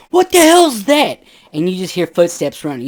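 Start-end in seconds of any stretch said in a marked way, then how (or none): 0.1-1.2 high, loud, startled shout from upstairs; startled yell. Retold or imitated. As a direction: yell it out of the dark, spooked. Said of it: "you hear out of nowhere".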